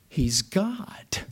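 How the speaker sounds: hushed, awed whisper. Acted. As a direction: drop to a whisper for the answer. Answers own question in hushed tone.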